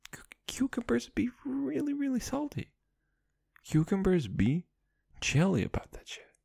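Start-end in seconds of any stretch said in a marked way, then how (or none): 0.1-2.7 high whisper. Real, not acted.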